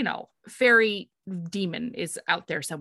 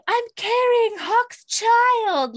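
very high, loud, slow proclamation; trumpeting announcement; 7 dB louder, much slower than usual. Retold or imitated. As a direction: proclaim it to the whole town. Heroine trumpeting news to towns.

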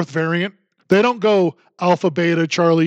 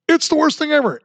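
higher mock panic; mock alarmist voice; 7 semitones higher than usual. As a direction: lampoon the doom crowd, pitched up. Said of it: "the contradictions from the left".

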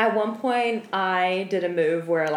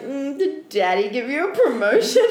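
mock baby talk; 7 semitones higher, 4 dB louder than usual. Mocking the client's daddy privilege.

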